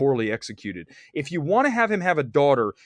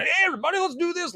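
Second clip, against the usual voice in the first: mock pumped-up greeting pitched way higher; mock hype guy; faster than usual. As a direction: play an over-eager guy rallying the room. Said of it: "I'm not, like, the guy going".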